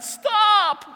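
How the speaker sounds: high-pitched shout of protest; screaming out. Retold or imitated. Preacher voicing his painful knee on the squat rack, screamed protest. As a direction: yell it as a knee screaming in pain. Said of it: "screaming out".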